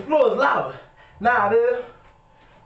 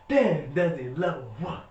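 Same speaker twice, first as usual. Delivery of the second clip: slow catchphrase; 8 semitones lower, 5 dB quieter, slower than usual. Acted. Signature outro catchphrase.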